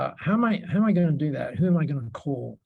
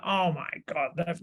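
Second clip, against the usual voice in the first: put-on abandoned stab at a Canadian accent; attempted Canadian accent; 5 dB quieter than usual. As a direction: try a Canadian accent, not very convincingly. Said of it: "I can't do the Canadian accent".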